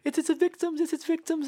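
high squeaky hammed-up panic; mock panicked girl. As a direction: shriek it like a scared girl in a horror movie. Girl panics over bones.

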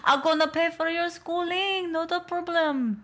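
high, hammy mock French accent. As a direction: play the doting auntie in French. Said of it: "does a French accent".

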